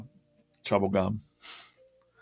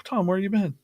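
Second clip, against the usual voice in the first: friendly call out; 12 semitones higher than usual. Retold or imitated. Retells message to sick friend.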